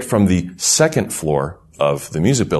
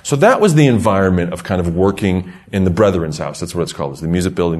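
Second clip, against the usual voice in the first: ordinary delivery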